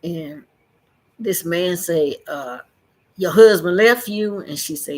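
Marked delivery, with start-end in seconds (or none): 3.2-4.4 loud, disbelieving question from the porch man; incredulous loud question. Retold or imitated. Porch man loudly questions the woman's story. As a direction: bark it in disbelief.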